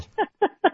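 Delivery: high, short burst of laughter; light high-pitched chuckle; amused laugh. Real, not acted. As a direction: laugh at the host's joke. Amused by sunglasses joke.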